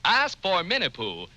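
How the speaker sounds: normal talking voice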